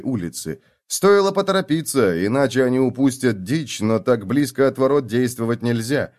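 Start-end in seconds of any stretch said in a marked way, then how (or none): none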